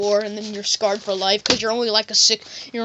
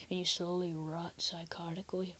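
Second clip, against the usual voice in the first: fading away; 3 semitones lower, 13 dB quieter than usual. Acted. Tale of decline in jail, fading.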